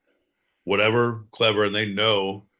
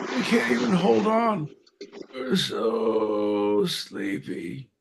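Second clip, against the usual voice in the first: sleepy button voice; 10 semitones higher, much slower than usual. Pretend. Voicing tired buttons.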